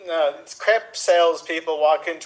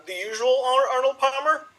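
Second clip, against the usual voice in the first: higher-pitched, rising bartender query; bartender's question; 8 semitones higher than usual. Acted. Bartender offering a regular his usual, questioning. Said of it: "bartender goes".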